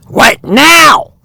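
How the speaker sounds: high indignant bird voice; shrieking bird. Acted. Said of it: "shrieked Oxpecker".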